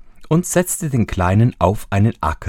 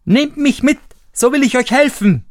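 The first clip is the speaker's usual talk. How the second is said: high little eager voice; high piping offer; tiny Daumestick; 4 dB louder than usual. A narrator's reading. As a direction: offer help like a tiny boy. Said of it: "Da sprach Daumestick wieder".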